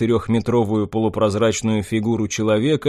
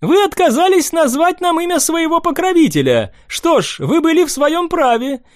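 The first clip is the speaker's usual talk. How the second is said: put-on high light young nobleman; young prince voice; 14 semitones higher, 3 dB louder than usual.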